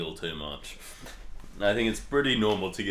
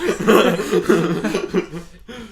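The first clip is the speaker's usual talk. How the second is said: nervous laughing; 9 semitones higher, 5 dB louder than usual. Real, not acted. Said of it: "I'm freaking out".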